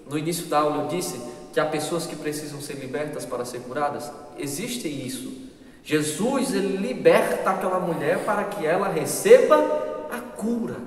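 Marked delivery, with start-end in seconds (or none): none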